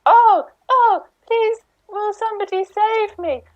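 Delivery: high, crying damsel begging for rescue; damsel in distress. Acted. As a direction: wail like a helpless damsel. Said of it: "it probably is a bit like this".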